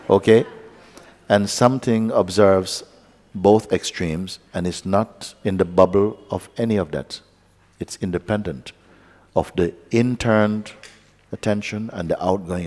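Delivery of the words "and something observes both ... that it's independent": ordinary talk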